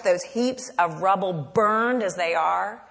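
ordinary talk, nothing marked

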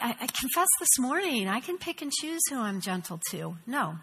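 ordinary delivery

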